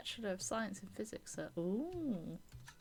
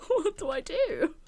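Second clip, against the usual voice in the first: laughing helplessly; 7 semitones higher, 11 dB louder than usual. Real, not acted. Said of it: "I don't wanna say it with, like, an accent".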